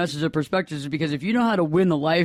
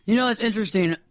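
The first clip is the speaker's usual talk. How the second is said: usual speaking voice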